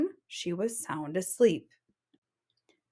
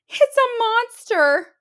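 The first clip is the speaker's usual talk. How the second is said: very high, loud, slow moan; Mama Bear moaning in fear; 13 semitones higher, 8 dB louder, slower than usual. Acted. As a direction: moan it in fright. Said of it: "moaned Mama Bear".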